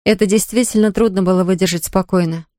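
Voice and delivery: ordinary delivery, nothing marked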